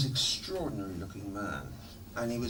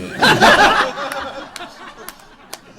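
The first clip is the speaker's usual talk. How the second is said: burst of laughter; 15 dB louder than usual. Real, not acted. Laughing at [name]'s voice.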